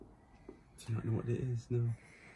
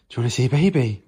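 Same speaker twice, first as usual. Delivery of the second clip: louder call to the dogs; 13 dB louder, faster than usual. Real, not acted. Calling the dogs to come see the baby, louder.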